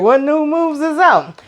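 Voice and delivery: high, drawn-out imitated query; mock wondering question. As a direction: ask it slowly in a put-on high voice. Imitating his reaction to new moves.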